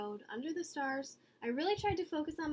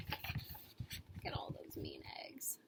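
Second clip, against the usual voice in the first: far lower, slow hushed aside; whisper; 12 dB quieter, slower than usual. A narrator's reading. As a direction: whisper it to the kids. Aside to children.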